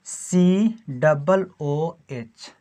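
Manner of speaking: each letter spelled out very slowly; slow dictation. Shown as a demonstration. Teacher dictating a formula to students, very slowly. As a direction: spell the formula out piece by piece for the class. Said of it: "teacher dictates formula slowly".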